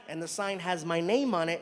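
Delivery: ordinary delivery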